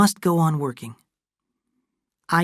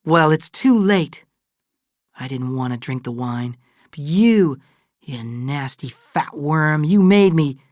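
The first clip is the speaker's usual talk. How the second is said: angry, with loud bursts on the blame; bitter accusation; 2 semitones higher, 4 dB louder than usual. Acted. Kate turns on Fay accusingly.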